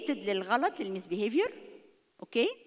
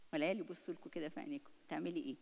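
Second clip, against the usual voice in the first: much softer, fast, hushed; quietly challenging the parent; hushed tense question; 5 semitones lower, 10 dB quieter, faster than usual. Shown as a demonstration. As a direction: lean in and ask under your breath. Kids staring at parent.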